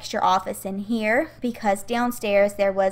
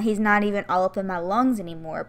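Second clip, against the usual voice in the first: usual speaking voice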